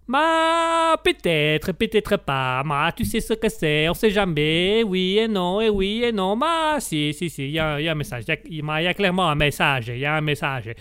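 put-on coy, teasing Italian accent. Italian guest hints at a message to host, teasing Italian accent. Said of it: "nous allons vous présenter l'Italien".

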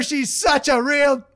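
ordinary talk

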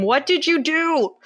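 high-pitched mock yell; imitated angry yelling. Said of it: "Katie starts yelling at him".

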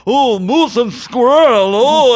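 mock a cartoon Russian villain in a bad Russian accent; fake Russian accent. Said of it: "the worst Russian fake accent".

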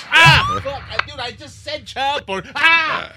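high, loud embarrassed groan; embarrassed yelp. Real, not acted. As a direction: cringe out loud. Embarrassed after the slip.

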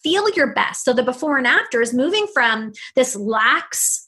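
ordinary delivery

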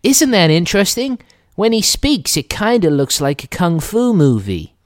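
high-pitched mock nerd; high, loud nerdy student impression. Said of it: "from the nerdy student".

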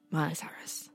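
hushed, breathy reveal of the name; whispered reveal. Acted. Podcast host whispering the artist's name to cohost. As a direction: whisper it like a secret.

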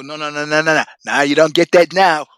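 loud furious retold shout, pushing hard; angry yelling. Shouting down a heckler who mouthed off, loudly furious. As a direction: bark it at him like you're about to swing. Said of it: "I flipped out".

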